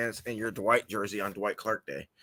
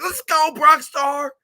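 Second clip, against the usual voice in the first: stretched-out stadium yell, much higher and louder; hype cheer; 14 semitones higher, 7 dB louder, slower than usual. Retold or imitated. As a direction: cheer him onto the field, slow and loud. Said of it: "I'm like".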